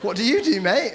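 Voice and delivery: normal talking voice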